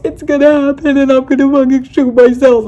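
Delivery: desperate yell. Retold or imitated. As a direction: shout it like you're losing it. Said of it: "I was threatening my life".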